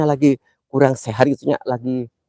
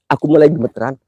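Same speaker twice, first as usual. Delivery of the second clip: ordinary delivery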